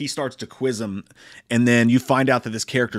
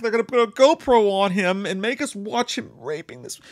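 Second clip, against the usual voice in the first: high panicked dread; 10 semitones higher, 4 dB louder than usual. Retold or imitated. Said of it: "you're like".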